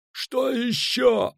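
high, long-stretched exasperated cry; drawn-out panicked shout; frantic yelling. A narrator's reading. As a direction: wail it out high and slow in desperation. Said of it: "орал, размахивая руками Пудлорок".

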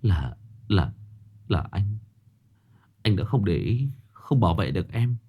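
mild, understated sorrow; bitter regret. Acted. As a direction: choke on the guilt. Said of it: "anh chua xót nói".

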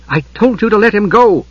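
exasperated woman giving an order; insistent female command. A narrator's reading. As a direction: insist sharply, pitch lifted. Cleric struggles to free the Kender.

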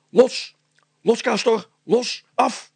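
high, loud barked commands; shouted commands. A narrator's reading. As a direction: call the dog off sharply. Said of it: "hij riep naar de hond".

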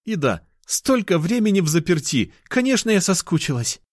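put-on high girlish voice; lovesick cat girl. Girl after long confinement, high and longing. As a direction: admit it in a high, eager voice.

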